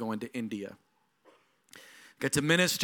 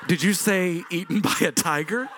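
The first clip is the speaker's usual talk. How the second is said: incredulous; 4 semitones higher, 6 dB louder than usual. Retold or imitated. Hearing about the tiger.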